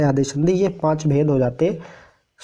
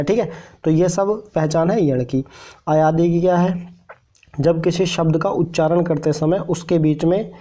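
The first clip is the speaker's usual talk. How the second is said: ordinary talk, nothing marked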